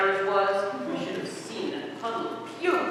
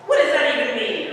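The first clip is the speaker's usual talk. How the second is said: raised, louder mock exasperation; mocking question; 6 semitones higher, 5 dB louder, faster than usual. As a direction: scoff at the silly word. Mocking William's made-up words.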